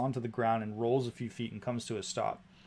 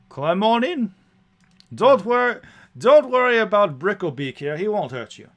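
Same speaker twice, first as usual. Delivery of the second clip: hearty driver, pitch leaping high on invitations; chatty coach driver; 5 semitones higher, 8 dB louder than usual. Acted. Driver waves passengers past his griffin, hearty and reassuring. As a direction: welcome a fare with loud, high bursts.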